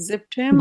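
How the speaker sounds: সেপ্টেম্বর with a Z-like start, ending ba; dropped final R. Shown as a demonstration. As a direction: say সেপ্টেম্বর as zep-tem-ba. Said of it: "রটা আর বলবেন না".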